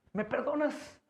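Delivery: quiet, tender plea for forgiveness; soft pleading question. Acted. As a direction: ask it small and hopeful. Soft plea after loud prayer.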